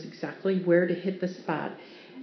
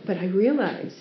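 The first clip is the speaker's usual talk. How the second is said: normal talking voice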